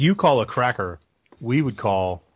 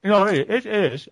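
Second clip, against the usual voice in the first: insisting; 10 semitones higher, 5 dB louder, slower than usual. Real, not acted. Insisting in a pronunciation argument.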